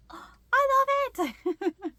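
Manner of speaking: cooing. Retold or imitated. Petting the bat's head, high delighted coo. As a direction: melt over it as it closes its eyes.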